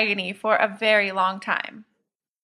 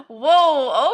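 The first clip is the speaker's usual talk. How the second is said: laughing; 4 semitones higher than usual. Acted. Laughs at stranger's answer.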